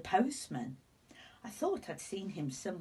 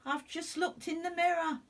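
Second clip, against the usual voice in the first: much higher, dismayed; alarmed; 9 semitones higher, 4 dB louder than usual. Acted. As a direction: fret aloud at the mirror. Alarmed at sunburn in mirror.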